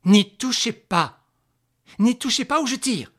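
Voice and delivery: loud, high-pitched warning shout; shouted threat. Acted. Mother warns the woman off her child, shouting. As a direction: shout it to stop her in her tracks.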